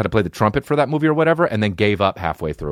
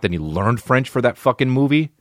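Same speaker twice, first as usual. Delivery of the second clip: usual speaking voice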